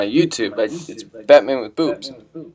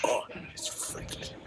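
whisper; 15 dB quieter, slower than usual. Real, not acted. Spooked by distorted video face.

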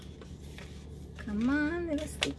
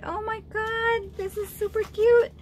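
excited; 9 semitones higher, 4 dB louder than usual. Real, not acted. Admiring a gift.

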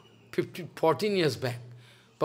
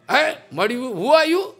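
raised, higher and louder, demanding who he is; angry challenge; 8 semitones higher, 5 dB louder than usual. Retold or imitated. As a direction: confront him sharply with a high, loud voice. Hostile priest confronting Mahaprabhu.

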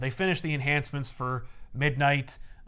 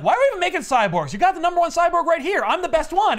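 higher, louder bragging cyborg voice; boastful Jeff; 13 semitones higher, 7 dB louder than usual. Acted. Jeff bragging to his bosses, high and loud. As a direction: brag loudly that he's the best. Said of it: "Jeff is like".

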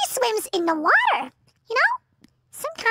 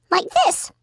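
ordinary talk, nothing marked

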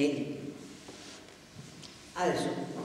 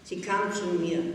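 ordinary delivery

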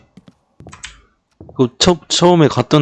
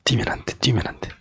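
unvoiced tense whisper; whispering while hiding; 4 semitones lower, 11 dB quieter, faster than usual. Real, not acted. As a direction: whisper it so nobody hears. Hiding from the witch.

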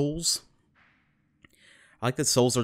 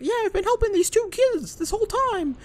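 squeaky, very high falsetto, louder; a hammed-up cartoon mouse voice; Mickey Mouse; 4 dB louder than usual. Mouse king telling of the kids he helped, loud squeaky falsetto. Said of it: "meets back up with Mickey".

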